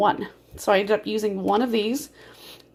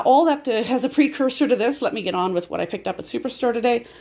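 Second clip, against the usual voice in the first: ordinary delivery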